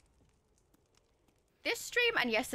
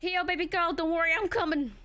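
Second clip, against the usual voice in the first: higher, louder heroic call; calling out don't worry to Ashley; loud reassurance; 8 semitones higher, 6 dB louder than usual. Acted. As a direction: shout comfort to the captive. Reassuring kidnapped character loudly.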